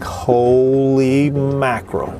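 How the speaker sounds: slow, drawn-out stunned disbelief. Retold or imitated. Watching the fireman and the dog, slow stunned disbelief. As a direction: let it out slowly, shocked speechless. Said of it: "And I'm like".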